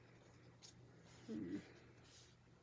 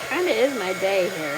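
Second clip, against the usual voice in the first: loud, raised voice; hollering; 14 dB louder than usual. Real, not acted. Crafter talking over the dryer, hollering. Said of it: "Sorry, guys, if I'm hollering".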